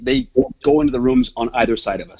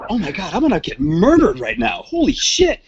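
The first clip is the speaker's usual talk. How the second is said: panic; 9 semitones higher than usual. Retold or imitated. Panicked at the naked door scene.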